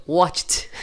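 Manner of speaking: WATCHED slowly as "watcht", no vowel after. Shown as a demonstration. Teacher corrects own slip, ED as T. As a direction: cut WATCHED off on an airy T.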